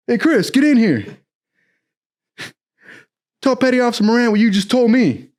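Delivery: high, urgent imitation of a chief; mock commanding call; ordering someone over. Chief summons, orders a retelling.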